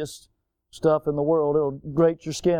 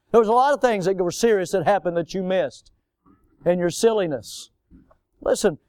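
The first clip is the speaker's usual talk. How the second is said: ordinary delivery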